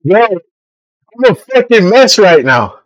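talking through a burning mouth; pained, mouth on fire; mouth burning from spicy food. Real, not acted. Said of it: "But this shit is burning".

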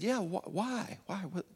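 a mild imitated whisper, startled; whispered surprise. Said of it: "And we're whispering".

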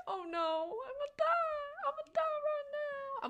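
high, hammed-up wail of doom, slow; mock panic. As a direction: squeal it like you're about to die. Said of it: "I was literally petrified".

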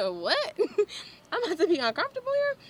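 high, loud incredulous question through laughter; laughing disbelief. Retold or imitated. Retold shocked reply to boss, laughing.

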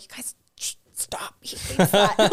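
urgent mock whisper to the kids; whispered retold scolding; acted hushing whisper. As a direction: shush them under your breath. Hushing kids during husband's order.

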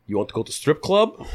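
put-on a light Italian accent, dropping the article; lightly imitating the young Italian pilot's Italian accent. Young Italian pilot invites the new co-pilot out, light Italian accent. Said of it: "full thick accent and everything".

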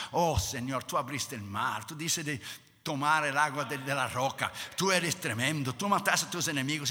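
low, reverent praise, growing slightly firmer; acted tender adoration; softly extol, one wonder after another; Moses praising God. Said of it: "Moisés llegaba delante de Dios y decía".

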